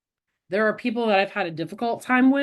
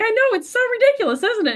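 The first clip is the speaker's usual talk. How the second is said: much higher, quick and louder friend voice; friend laughing at herself; 13 semitones higher, 4 dB louder, faster than usual. Retold or imitated. Friend admitting her anger is silly, much higher and quick. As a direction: toss it off high and fast, amused at herself. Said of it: "She's like".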